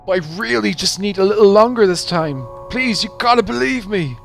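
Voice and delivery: high, loud desperate begging; pleading. Acted. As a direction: plead urgently, pitched well up. Debtor begging loan sharks.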